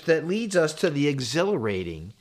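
usual speaking voice

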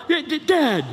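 high, loud whining Dad, Dad; whining teen. Acted. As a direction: wail at dad like a sulky teen. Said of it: "that's how it sounds".

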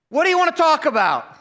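high, loud, strained cheery question; nervous fake brightness. Pastor asking the feared man why he came, nervous cheer. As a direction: ask it brightly while dreading the answer.

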